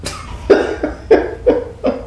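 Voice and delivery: breathy high-pitched giggle; high laugh. Real, not acted. Laughs at own joke.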